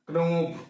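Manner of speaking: knob said as "k-nob", k pronounced; sounded K. Shown as a demonstration. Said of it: "Why we not call". (knob में K बोलकर)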